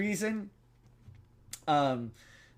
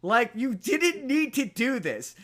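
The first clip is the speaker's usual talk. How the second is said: high, slow, drawn-out exasperation; exasperated pleading; 8 semitones higher, slower than usual. Acted. Scolding the bungling kidnappers for a needless killing, exasperated. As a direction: stretch the words out, pitched high in mock despair.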